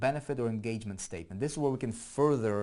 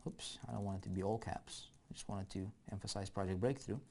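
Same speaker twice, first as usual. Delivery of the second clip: ordinary talk, nothing marked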